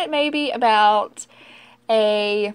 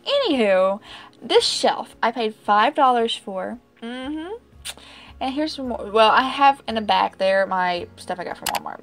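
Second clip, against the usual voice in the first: normal talking voice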